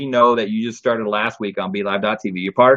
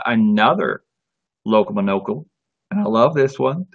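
ordinary talk

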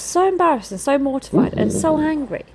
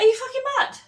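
outraged disbelief; 13 semitones higher, faster than usual. Retold or imitated. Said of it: "I was like".

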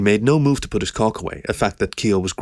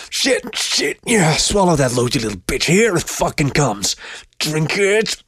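strained, high-pitched urgent exclaiming; urgent commands forced out under strain; climactic outburst; 7 semitones higher, slower than usual. A narrator's reading. Dog character commanding his partner at climax, strained urgent outburst.

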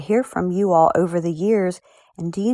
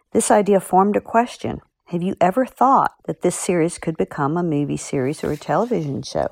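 usual speaking voice

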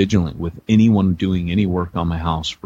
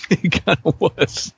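higher, slow, through laughter; amused confession broken by laughs; laughing admission; 9 semitones higher, slower than usual. Real, not acted. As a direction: own up while cracking up. Laughs at listener's teasing question.

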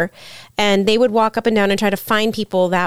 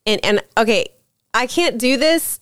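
normal talking voice

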